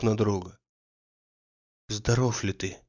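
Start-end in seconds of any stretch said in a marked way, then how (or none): 1.9-2.8 softer, tender question; gentle concern. A narrator's reading. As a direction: ask softly, worried for his friend. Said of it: "спросил Андрей". (тихий заботливый вопрос)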